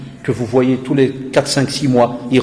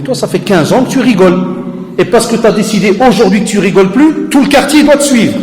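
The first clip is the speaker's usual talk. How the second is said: raised scolding voice, higher and louder; retold scolding; 7 semitones higher, 6 dB louder than usual. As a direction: scold him hard, building up. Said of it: "Je dis".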